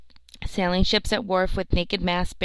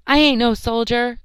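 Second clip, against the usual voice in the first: loud, higher cry of protest; cried out; 5 semitones higher, 7 dB louder than usual. Acted. Charlie crying out to the unseen voice, loud and high. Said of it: "cried Charlie".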